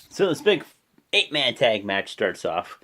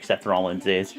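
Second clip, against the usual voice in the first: ordinary talk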